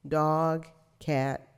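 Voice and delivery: ordinary talk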